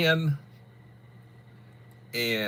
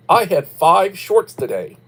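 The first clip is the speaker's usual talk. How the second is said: excited; 4 semitones higher, 10 dB louder, slower than usual. Real, not acted. Proud brag about five shorts.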